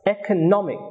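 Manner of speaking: "economic" with main stress on "nom"; stress on third syllable. Shown as a demonstration. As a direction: lighter beat on "e", strongest on "nom" in "economic". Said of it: "primary stress is on the third syllable".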